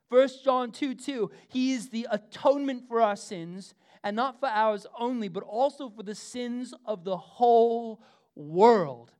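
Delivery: loud, high, fervent pulpit delivery; full-voiced sermon cadence; preaching. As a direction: preach it to the whole room.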